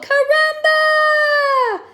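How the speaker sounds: slow worried calling shout. Acted. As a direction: yell his name across the water. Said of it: "they called".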